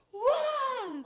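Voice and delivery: loud, high cheerleader whoop. Retold or imitated. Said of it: "tipo uma cheerleader acordando".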